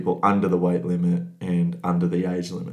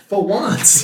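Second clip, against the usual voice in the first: laughing; 13 semitones higher, 4 dB louder than usual. Real, not acted. Mocking echo with laughter.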